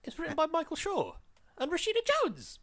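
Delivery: stunned high echo; astonished. Real, not acted. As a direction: echo the names in disbelief. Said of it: "Bloody hell, really?".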